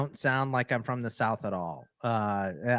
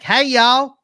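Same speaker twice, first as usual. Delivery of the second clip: high, loud hammed-up Texas twang; Texas accent; 7 semitones higher, 5 dB louder, slower than usual. Back home in Texas greeting fellow kin, exuberant Texas accent. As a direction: greet folks in a big Texas drawl. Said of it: "then I moved back to Texas".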